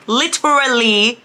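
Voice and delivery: "literally" drawn out high and loud; exasperated emphasis. Real, not acted. Fan retelling the bad date, "literally" drawn out in exasperation.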